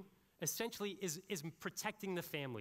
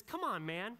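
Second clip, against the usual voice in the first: exasperated "come on, man"; 5 semitones higher, 4 dB louder than usual. Retold or imitated. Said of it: "He says, he says".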